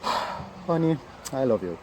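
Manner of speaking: sigh, then say it half-heartedly; reluctant and meaningless, after a heavy sigh. Shown as a demonstration. Husband or wife telling a partner "honey", reluctant and sighing. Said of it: "you don't want to say it".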